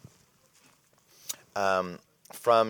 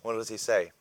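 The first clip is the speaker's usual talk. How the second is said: ordinary delivery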